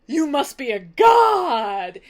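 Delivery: loud, drawn-out mock awe; freaking out. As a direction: cry it out slowly like an awestruck son. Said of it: "Telemachus freaks out".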